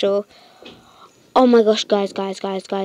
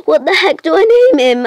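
exasperated cry; 7 semitones higher, 7 dB louder than usual. Real, not acted. Frustrated naming the pet.

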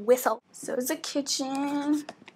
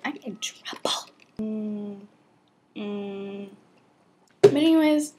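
usual speaking voice

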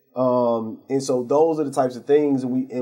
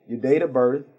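usual speaking voice

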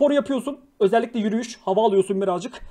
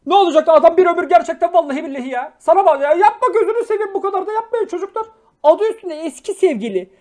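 loud, fed-up telling-off; loud, exasperated scolding of a viewer; 4 semitones higher, 6 dB louder than usual. Real, not acted. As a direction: scold the chat like a big brother. Scolding chat about ex's engagement.

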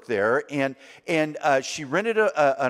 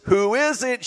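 raised, high call at the door; 6 semitones higher, 4 dB louder than usual. Retold or imitated. Brother asks who is knocking, calling out.

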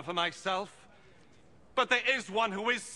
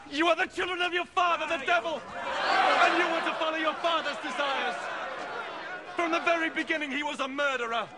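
stern rebuke; 6 semitones higher than usual. Acted. Jesus rebukes the hostile crowd.

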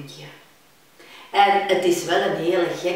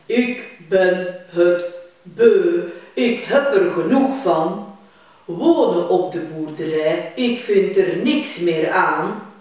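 fed-up cow; 8 dB louder than usual. Acted. Cow complaining to her calf, fed up. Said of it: "cow fed up with farm".

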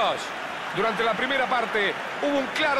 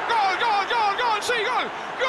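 breakneck goal commentary; 9 semitones higher, slower than usual. Acted. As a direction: scream the goal over and over. Second goal called. (grito de gol eufórico)

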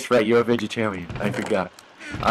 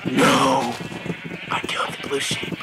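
gasping, hushed dismay; gasp, then breathe out the awful realisation; mock horror; 2 semitones lower, 4 dB quieter than usual. Player who killed the blue sheep, mock horror.

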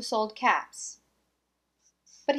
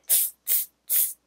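soft unvoiced teasing tss; monkey tsk sound; 13 dB quieter than usual. Monkeys mocking the peddler, soft voiceless tss. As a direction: hiss back like cheeky monkeys. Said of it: "and said".

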